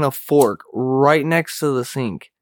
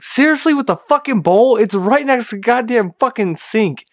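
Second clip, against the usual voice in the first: annoyed rant; 9 semitones higher, 3 dB louder than usual. Retold or imitated. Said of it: "And I'm just like".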